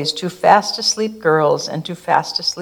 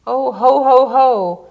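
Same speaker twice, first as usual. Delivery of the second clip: higher, louder ho ho ho; jolly chuckle; 6 semitones higher, 5 dB louder than usual. Acted. Santa stuck in the chimney, hearty chuckle. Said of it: "It starts as a chuckle".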